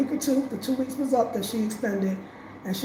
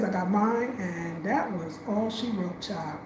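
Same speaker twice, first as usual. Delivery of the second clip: ordinary talk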